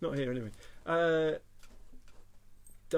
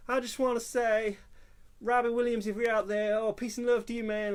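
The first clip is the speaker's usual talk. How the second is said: American accent, hammed-up and higher; fake American accent; 8 semitones higher, 6 dB louder than usual. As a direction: put on a gushing American awards-show accent. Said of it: "an American accent".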